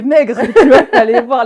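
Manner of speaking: loud laughter breaking into the words; laughing. Real, not acted. Guest mocking her thin wallet at eighteen, laughing. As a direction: laugh it out, really cracking up.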